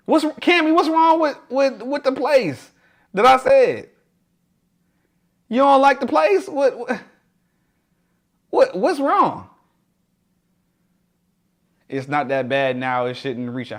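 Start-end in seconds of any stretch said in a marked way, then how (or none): 0.1-9.5 incredulous. Real, not acted. Reacting to viewer Cammy's dislike.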